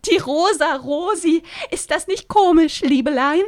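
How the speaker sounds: high, loud chirpy busybody; gushing Rosi. Acted. Rosi fussing over Lynn's sunburn, high and chirpy.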